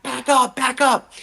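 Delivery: high-pitched mock yell; urgent, high-pitched contained shout; retold scream. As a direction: imitate screaming at the driver, without full volume. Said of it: "scream at the guy".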